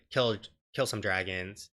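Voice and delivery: normal talking voice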